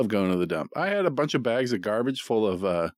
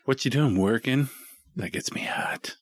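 slow, sultry come-on ending in a hush; seductive hotline voice; 6 semitones higher, slower than usual.

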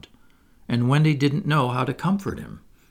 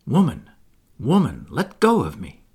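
mildly urgent inner plea, a little louder; inner cry; 4 dB louder than usual. A narrator's reading. Wendy's inner self pleads to be let go, crying out. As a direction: cry out lightly, pleading for release. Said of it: "Something inside her was crying".

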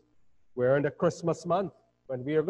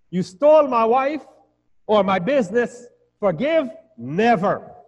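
higher, louder acted outrage; mock indignation; 6 semitones higher, 5 dB louder than usual. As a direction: act the wronged party refusing to forgive. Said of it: "we like to package sins in degrees".

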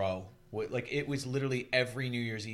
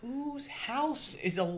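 very high, slow, drawn-out disbelief; retold astonishment, stretched way up high; incredulous; 4 dB louder, much slower than usual. Guest stunned by windows shaking from music, high incredulous mock wonder.

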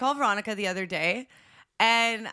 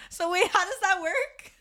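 high-pitched, giggly question; amused, baffled and laughing; laughing disbelief; 9 semitones higher than usual. Real, not acted. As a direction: ask it through real laughter, pitch way up. Laughing disbelief at cake news.

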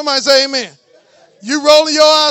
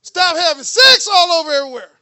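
full-force preaching; 4 semitones higher, 11 dB louder than usual. Acted. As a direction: preach it out at the top of your voice to the church.

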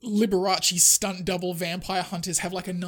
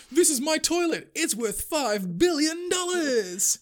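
higher, slow, showing off a priceless toilet; smug Vatican host; 10 semitones higher, 4 dB louder, slower than usual. Retold or imitated. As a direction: proudly present it to the camera crew. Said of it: "he's like".

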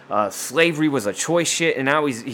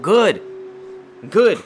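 loud, high clipped approval, twice; emphatic approval; 5 semitones higher, 3 dB louder than usual. Real, not acted. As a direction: bark it out like you mean it. Approving Kim locking him up.

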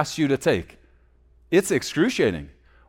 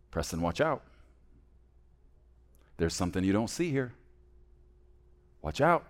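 much softer, low and caring caution; gentle father's warning; 8 semitones lower, 10 dB quieter than usual. Retold or imitated. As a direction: warn tenderly, like a loving father. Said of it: "It's more like this".

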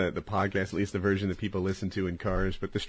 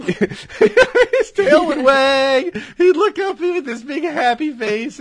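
high, loud, very slow words through laughter; laughing; 9 dB louder, much slower than usual. Real, not acted. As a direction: laugh fondly while telling it. Laughing remembering dead dog.

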